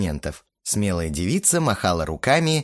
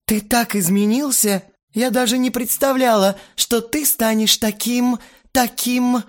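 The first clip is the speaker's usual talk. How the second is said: put-on gushing girl; 12 semitones higher, 3 dB louder than usual. A narrator's reading. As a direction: gush like an infatuated teen. Лена gushing over him.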